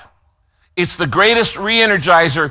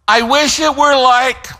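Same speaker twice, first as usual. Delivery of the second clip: higher-pitched mock complaint; wistful grumble, pitched up; mock griping; 7 semitones higher than usual. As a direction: imitate someone griping about wanting things back. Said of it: "stop complaining and griping and going".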